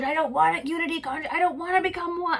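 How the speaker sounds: panicked pleading. Retold or imitated. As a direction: push the terror up into a high cry. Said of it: "I'm like".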